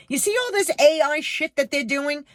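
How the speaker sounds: pointed, heated jab; mock scolding. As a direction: tell the cashier off. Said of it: "how I wanted to say to this girl".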